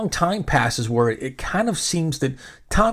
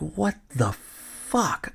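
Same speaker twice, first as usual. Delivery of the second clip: slow, softer muttered disbelief; stunned disbelief; 2 semitones higher, 6 dB quieter, much slower than usual. Retold or imitated. Fan snubbed by his idol, in stunned slow disbelief. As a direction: drag it out, too shocked to believe it.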